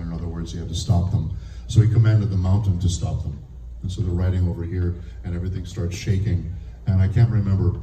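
normal talking voice

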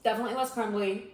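chewing while talking; talking between bites; mouth full. Real, not acted. Seen 0: Unsure who speaks; both hold food at mouth, chewing throughout.

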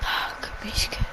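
mysterious unseen voice whispering; very soft, voiceless whisper. Pretend. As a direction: whisper it like a hidden spirit voice. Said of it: "znowu takie szepty".

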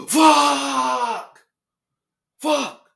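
high, loud, raw yell of rage; furious scream. Real, not acted. Gamer screams after losing the match.

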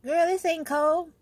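high, loud, slow sassy drawl; sassy friend voice. Retold or imitated. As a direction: scoff at her like a tough New Yorker. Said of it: "my friend will say".

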